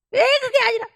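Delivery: put-on high, loud childish whine; whiny child protest. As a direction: whine back like a stubborn kid. Child protesting in retold scene.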